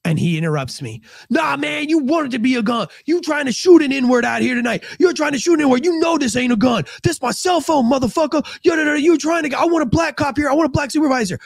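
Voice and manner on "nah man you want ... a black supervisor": angry suspect yelling. Retold or imitated. Passenger yelling at the officer, high and angry. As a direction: shout him down like a scared, furious young man.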